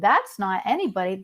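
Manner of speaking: ordinary talk, nothing marked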